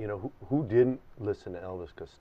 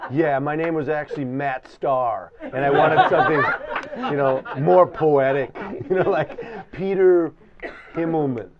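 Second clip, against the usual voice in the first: laughing; 4 semitones higher, 7 dB louder than usual. Retold or imitated. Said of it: "I'm like".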